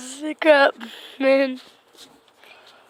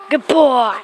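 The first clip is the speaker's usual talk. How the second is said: loud praise; 7 dB louder than usual. Real, not acted. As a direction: call out praise to the cat. Praising cat while carrying it.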